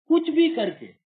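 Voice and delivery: high, loud exclamation on भी; sudden exasperated cry; emphatic outburst. Real, not acted. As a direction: throw the line out hard. Emphatic outburst after refrain.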